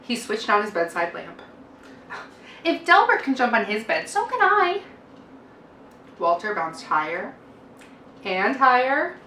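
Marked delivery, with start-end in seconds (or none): 2.6-4.8 put-on high, eager little boy; excited child. A narrator's reading. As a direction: dare yourself like a kid. Walter decides to jump.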